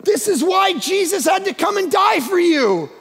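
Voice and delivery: forceful pulpit shout; preaching shout. Real, not acted. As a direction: drive it home at full volume.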